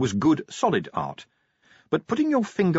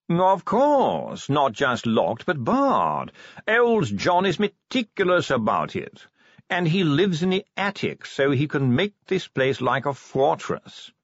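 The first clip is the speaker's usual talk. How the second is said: higher, emphatic curator voice; 6 semitones higher, slower than usual. Acted. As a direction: assure them with fussy emphasis. Curator boasts of security.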